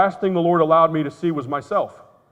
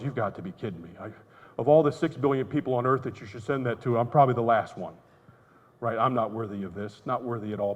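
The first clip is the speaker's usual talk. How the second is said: humbled prayer; 4 semitones lower, 6 dB quieter than usual. Retold or imitated.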